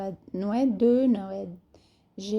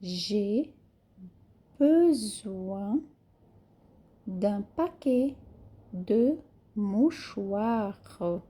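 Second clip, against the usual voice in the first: very slow de mouchoirs with the final R of mouchoirs sounded; very slow teacher demo; very slow model reading; much slower than usual. Shown as a demonstration. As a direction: draw it out syllable by syllable. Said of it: "Aqui o R é bem falado".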